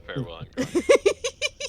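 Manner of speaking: high, slow croak with a laugh; croaking old crone. Acted. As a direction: cackle it out like an old hag. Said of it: "She says".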